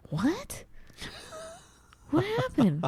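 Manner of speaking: high, lost "What? What happened?"; baffled high voice. Retold or imitated. Mimicking herself to her husband, high and confused. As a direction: sound completely bewildered. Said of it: "I can hear myself".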